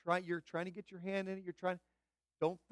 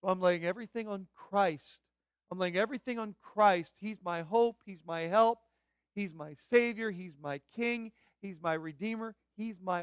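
loud, punched declarations; fervent preaching; 3 semitones higher, 7 dB louder than usual. Real, not acted. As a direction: proclaim each phrase to the congregation. Preaches a building declaration of faith.

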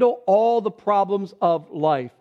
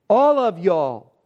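"All of y'all" with a slow, loud ALL; emphatic all of y'all; 3 dB louder, slower than usual. Shown as a demonstration. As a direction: stretch and punch "All" in "All of y'all". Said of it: "really emphasize".

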